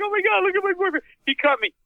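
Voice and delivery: high shrill-pitched mock panic of the girlfriend; panicked girlfriend. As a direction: play the frantic girlfriend, way up high. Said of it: "His girlfriend's like".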